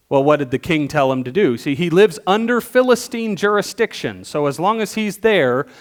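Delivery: normal talking voice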